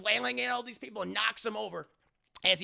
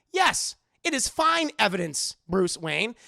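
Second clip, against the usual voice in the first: over-enunciate like a too-cheery robot; robot mayor; 6 dB louder than usual. Acted. Robot mayor answers Bruce about the evidence, loud and stilted. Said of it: "Like Robo Mayor Hill".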